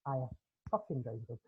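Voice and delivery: low, soft Scottish deadpan; deadpan retort. Acted. Scot answering Southerner about missing kid, deadpan. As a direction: drop it quietly, low and dry.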